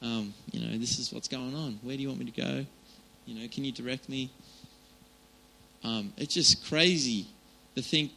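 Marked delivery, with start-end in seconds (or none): 0.0-4.3 softer, close, talking to a dad; hushed and familiar, like a child to a dad; quiet, intimate prayer. Pretend. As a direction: speak softly to God as your father. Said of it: "come to him and say".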